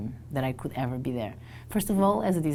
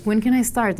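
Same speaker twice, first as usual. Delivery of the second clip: eager; 8 semitones higher, 7 dB louder than usual. Retold or imitated. Eager reply to the job offer.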